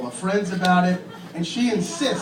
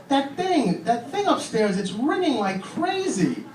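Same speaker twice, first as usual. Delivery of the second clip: put-on child's voice; 8 semitones higher, slower than usual. Child voice, high.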